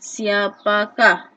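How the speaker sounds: "siapakah" drawn out slowly, syllable by syllable; teacher's slow model word; slow demo. Shown as a demonstration. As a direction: stretch the question word slowly for pupils. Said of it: "kata tanyanya ialah siapa".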